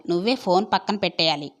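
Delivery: usual speaking voice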